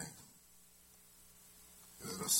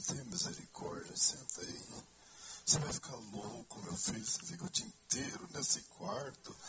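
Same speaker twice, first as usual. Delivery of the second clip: son voicing his mother, a bit higher; a light hint of a fretting mother; worried mother. Son quoting his mother's worried pleading, lightly voiced. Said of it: "Minha mãe falou".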